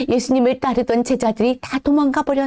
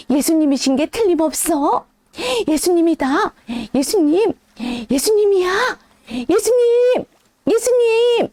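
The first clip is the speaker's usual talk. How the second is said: rising excitement; 6 semitones higher than usual. Acted. John shouting to the disciples that it is Jesus, building excitement.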